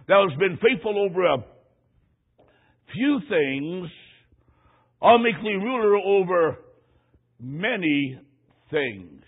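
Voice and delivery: slow, solemn reading of scripture. Acted. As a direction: intone the words of the Master.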